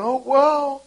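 high, loud, tearful gushing thanks; tearful gushing person. Retold or imitated. A grateful stranger thanks the preacher, high and tearful. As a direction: pour out thanks, voice high and breaking. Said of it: "Somebody's going".

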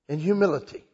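ordinary talk, nothing marked

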